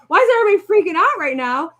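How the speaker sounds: put-on a touch of a confused child. Son asks why everyone panics, quick and high. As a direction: rattle it off like a bewildered little boy. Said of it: "He's just like".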